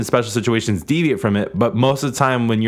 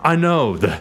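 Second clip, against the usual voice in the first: ordinary talk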